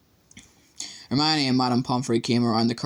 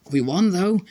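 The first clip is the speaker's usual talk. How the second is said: grinning; 7 semitones higher, 4 dB louder than usual. A narrator's reading. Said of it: "a grin breaking across his face".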